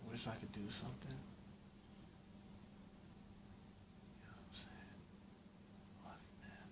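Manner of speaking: very soft, fading to a whisper; hushed consoling. Real, not acted. Sorry for a struggling friend, hushed consoling. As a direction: murmur it gently, almost under your breath.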